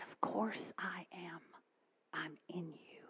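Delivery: soft, intimate near-whisper; quiet voice of Jesus, retold; hushed reverence. Retelling Jesus speaking at bedside.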